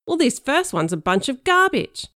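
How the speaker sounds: loud, impatient cop dismissing the note; gravelly impatient cop. Pretend. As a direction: bark it out like a fed-up cop. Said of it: "gravelly and impatient".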